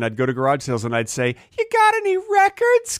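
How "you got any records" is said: put-on high squeaky little kid asking. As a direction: talk like an eager young kid, way up high. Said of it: "'Cause that's how I talked when I was a kid".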